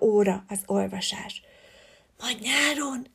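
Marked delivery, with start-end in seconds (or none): 2.2-3.1 soft, hushed aside; whispered. A narrator's reading. Szilvi murmurs softly to Leopold during class. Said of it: "súgta oda Szarvas Szilvi".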